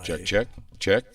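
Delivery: slow rising checks with echo; echoing mic check. Real, not acted. Host testing the studio mic effect, echoing checks. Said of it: "We're echoing".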